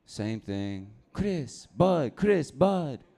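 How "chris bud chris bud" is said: higher sing-song street guy greeting; mock street guy call. Park guy greeting him a third day, higher sing-song. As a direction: toss it out higher, teasing.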